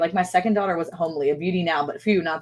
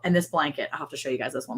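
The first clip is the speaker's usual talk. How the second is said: ordinary delivery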